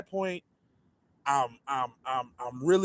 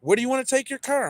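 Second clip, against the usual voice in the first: lightly voiced dealer, higher; a hint of a chirpy salesman; 9 semitones higher than usual. Dealer on the phone assures the caller, higher voice.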